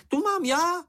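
very high, piping interjection; indignant little creature. Acted. Čenich claims the cave as his own, indignant squeak. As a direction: butt in high and possessive.

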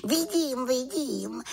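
high-pitched little witch; malá čarodějnice. A narrator's reading. (vysoký hlásek malé čarodějnice)

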